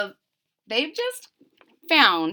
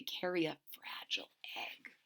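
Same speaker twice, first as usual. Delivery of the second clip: whispered aside; 6 semitones lower, 17 dB quieter than usual. Real, not acted. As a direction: whisper it to the listener.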